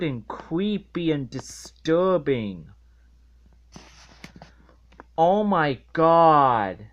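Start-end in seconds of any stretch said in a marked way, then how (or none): 5.2-6.8 disbelief. Real, not acted. Disgusted exclamation.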